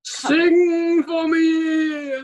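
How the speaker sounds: slow, drawn-out, loud dramatic call; hammed-up theatrical demand; mock Phantom command. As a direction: belt it like the Phantom summoning Christine.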